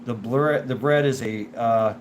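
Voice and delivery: ordinary talk, nothing marked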